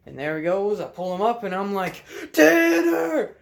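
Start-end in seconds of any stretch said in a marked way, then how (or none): 2.3-3.4 high, drawn-out holler to a far-off friend; screaming. Retold or imitated. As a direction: re-enact a scream across the docks. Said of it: "I'm screaming".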